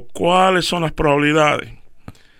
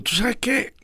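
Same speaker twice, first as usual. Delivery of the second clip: sudden idea; 9 semitones higher, 6 dB quieter, slower than usual. Retold or imitated. Father thinking aloud.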